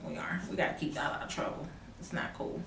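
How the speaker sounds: breathy hushed complaint, fast at the end; whispered aside. Real, not acted. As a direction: let the viewers in on a secret. Confiding aside to viewers.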